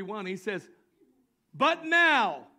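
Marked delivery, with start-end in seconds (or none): 1.5-2.5 preaching at full force. Acted. Preaching the scripture aloud.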